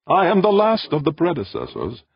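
high, projected ship's voice; calm tenor. A narrator's reading. Ancient machine introduces itself to the visitor, calm tenor. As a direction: announce yourself in a high, composed tenor. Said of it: "said a calm, sweet tenor voice".

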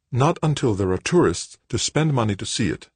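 mother putting her foot down; firm reply. A narrator's reading. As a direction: answer with measured firmness. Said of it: "Joanna replied firmly".

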